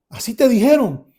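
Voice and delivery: high, loud amazed question; astonished question. Retold or imitated. Son checks what doctors told father, astonished. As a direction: ask it in amazement.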